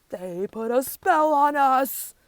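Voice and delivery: loud, slow accusing cry; outraged cry. A narrator's reading. Animals blaming the warthogs, slow loud cry. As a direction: drag it out, loud and indignant. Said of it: "cry the other animals".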